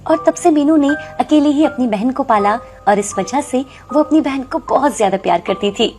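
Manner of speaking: ordinary delivery, nothing marked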